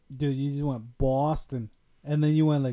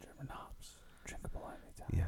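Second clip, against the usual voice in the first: whispered ad echo; 23 dB quieter than usual. Acted. Echoing the ad copy.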